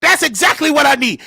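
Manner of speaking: ordinary talk, nothing marked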